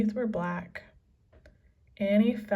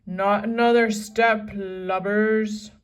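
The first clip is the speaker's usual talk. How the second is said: loud gruff warning; menacing pirate threat; 9 dB louder than usual. A narrator's reading. Captain aiming pistols at the kids, loud threat. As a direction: threaten at gunpoint. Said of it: "He aimed his pistols".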